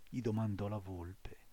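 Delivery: ordinary delivery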